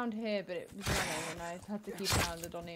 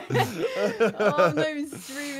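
laughing; 9 dB louder, much slower than usual. Real, not acted. Laughing at own situation.

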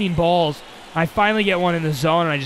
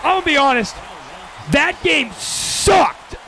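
frustrated outburst; 7 semitones higher, slower than usual. Real, not acted. Game goes badly, frustrated outburst.

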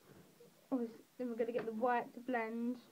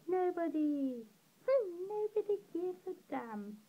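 playful self-mockery; 6 semitones higher than usual. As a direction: lift it high and stretch it out playfully. Answers own question "who cares?.

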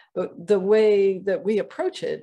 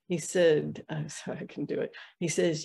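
ordinary talk

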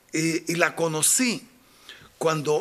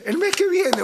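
put-on girl's voice; 13 semitones higher, 4 dB louder than usual. Girl answers eagerly.

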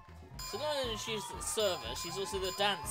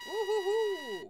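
cheering; 13 dB louder, slower than usual. Real, not acted. Cheering in game.

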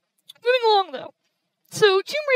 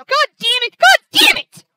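furious yelling that climbs higher and louder; real rage, escalating with each repeat; pissed off; 8 semitones higher, 14 dB louder, slower than usual. Real, not acted. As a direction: build to an enraged, drawn-out scream. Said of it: "I'm so pissed the fuck off right now".

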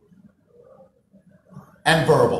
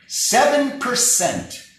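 "Seven" drawn out slowly and higher; stress on seven; 12 semitones higher, 4 dB louder, much slower than usual. Shown as a demonstration. Speaker stressing the statistic to the room, slow and emphatic. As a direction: lean hard on "Seven percent", slow. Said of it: "It's called the seven thirty-eight fifty-five rule".

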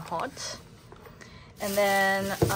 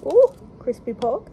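excited; 4 semitones higher, 7 dB louder than usual. Real, not acted. First bite excitement.

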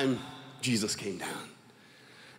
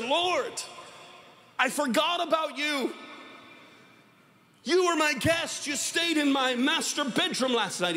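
high, drawn-out exclamation to the Lord; acted high-pitched astonishment; sudden realization; 9 semitones higher than usual. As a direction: cry out as the forgetful host suddenly remembering. Man exclaims to Jesus in story.

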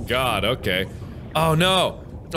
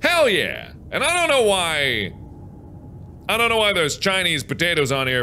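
ordinary delivery

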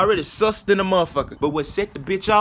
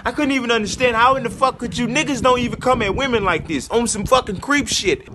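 ordinary talk

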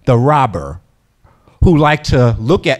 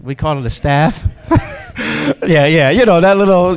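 ordinary talk

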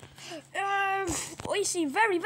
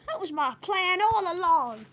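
ordinary delivery, nothing marked

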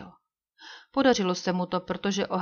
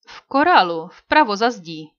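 Hawkins answering; 9 dB louder than usual. A narrator's reading. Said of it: "odpověděl Hawkins".

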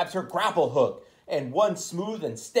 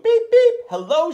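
cheery beep hello; 11 semitones higher, 7 dB louder than usual. Acted. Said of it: "she says".

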